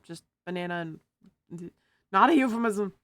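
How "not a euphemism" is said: loud, punchy insistence; loud emphatic. Real, not acted. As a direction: say it loud and firm. Joke punchline.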